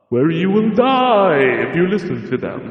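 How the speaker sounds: 夸张的玩笑式警告; mock warning. Joking mock warning, admits kidding.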